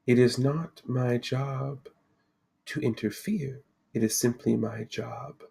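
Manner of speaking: soft, measured, unhurried seer; serene Lady of the Lake. Acted. Lady answers the party's distrust, calmly mysterious. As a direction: answer calmly, pausing between phrases. Game master voices the Lady.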